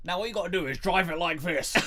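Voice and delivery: mock character voice. Dubbing a film character for a laugh, loud put-on voice.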